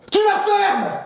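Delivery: put-on high furious shout at his kid; angry father yelling. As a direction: blow up at the kid like an enraged dad. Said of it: "c'est celle qui gueule".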